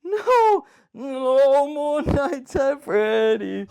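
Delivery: real terrified whimpering; crying in fear. Real, not acted. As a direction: sob it out after a terrible fright. Jump scare, crying out.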